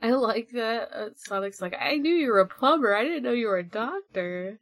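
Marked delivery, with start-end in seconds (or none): none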